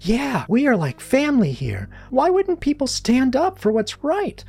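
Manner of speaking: high-pitched students protesting; 학생들의 항의. A narrator's reading. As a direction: voice indignant pupils in a high, insistent pitch. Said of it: "began talking all at once".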